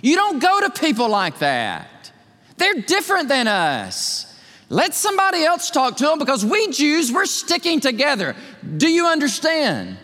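mock-pious scolding voice, pitched high; scolding Judaizers. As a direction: lecture Peter like a stubborn elder. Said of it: "kept on debating Peter and saying".

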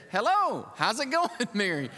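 high, chummy mock-angel hello, with a laugh; casual angel greeting. Preacher imitating the angel Gabriel greeting Mary, breezy and high. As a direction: greet her like an offhand buddy angel. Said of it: "a Gabriel angel came to you and said".

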